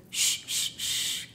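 put-on whispered shh to quiet a crying child; soft hushing sounds; shushing. Shown as a demonstration. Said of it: "callamos las emociones de nuestros hijos diciéndoles".

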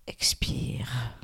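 low, soft, whispery exhale cue; breathing cue. Shown as a demonstration. Guide telling listeners to breathe out, low whispery cue. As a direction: sink down into a hushed out-breath.

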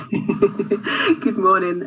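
laughing. Real, not acted. Guest laughs as she begins.

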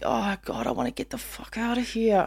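hushed, half-whispered longing to escape; private thought. Retold or imitated. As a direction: whisper it like a desperate thought in bed. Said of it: "You lie in bed and think".

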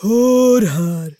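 slow, drawn-out attempt at a dull voice; put-on dull voice, stretched vowels; muffled deep-set voice. Shown as a demonstration. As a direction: recalibrate into a hollow, heavy call. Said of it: "jag får kalibrera igen".

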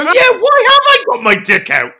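very high, loud comic panic; mock-horrified shout. Imagining himself caught naked in the changing room, mock-horrified shout. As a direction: echo the accusation in a hammed-up squeal.

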